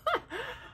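high, delighted giggle; a squealing little laugh; giddy laugh. Real, not acted. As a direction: laugh in happy disbelief. Laughs at being recognized.

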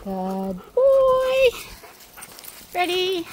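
high, sing-song dog praise; squeaky praising a dog. Real, not acted.

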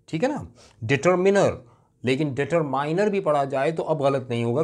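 normal talking voice

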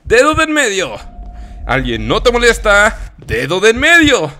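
high, loud, playful proclaiming; hammed-up, triumphant question and answer; mock sales pitch. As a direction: proclaim the silly advice with gusto. Gives mock life advice, triumphant.

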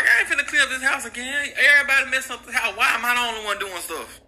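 put-on a teen refusing chores, exasperated; fed-up teen. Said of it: "And he was like".

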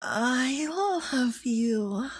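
slow, soft and loving, rising at the end; tender I love you. Acted. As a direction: linger on each word like a fond goodbye. Roleplay partner's parting words.

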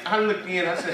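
normal talking voice

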